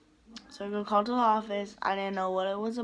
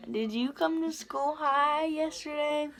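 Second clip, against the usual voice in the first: a lightly voiced counselor, higher and slow; 6 semitones higher, slower than usual. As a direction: imitate the counselor's probing question. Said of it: "my guidance counselor was like".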